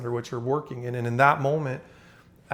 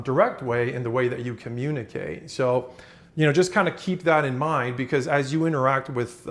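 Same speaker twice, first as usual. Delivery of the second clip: ordinary talk, nothing marked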